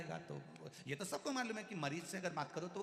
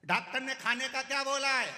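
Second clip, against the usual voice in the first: much higher and louder asking; shouted question; 10 semitones higher, 10 dB louder than usual. Acted. Deaf visitor shouting to ask the patient what the doctor allowed. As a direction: shout it to a patient you can't hear.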